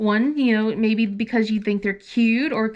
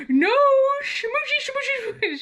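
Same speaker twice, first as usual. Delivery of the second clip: much higher, singsong tease; teasing mom; 14 semitones higher than usual. Retold or imitated. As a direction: coo it to make her squirm. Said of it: "I'm like".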